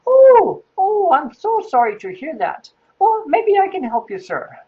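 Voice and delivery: very high, sympathetic English nanny; put-on English lady, loud high opening; Missus Doubtfire. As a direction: coo in a hammed-up falsetto matron voice. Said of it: "so I decided that's what I was gonna do".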